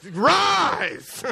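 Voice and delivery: high, booming mock command; mock Lazarus command. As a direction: call it out like raising Lazarus. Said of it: "kind of like a Lazarus".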